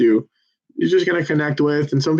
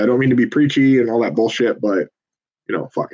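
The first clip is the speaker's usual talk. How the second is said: normal talking voice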